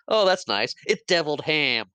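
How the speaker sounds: mock sweet cook. Cook Buckman answering Marty's complaint, mock sweetly. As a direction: chirp it back brightly like a cheeky cook. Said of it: "He's like".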